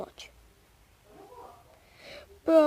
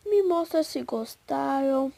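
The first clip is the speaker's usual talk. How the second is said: usual speaking voice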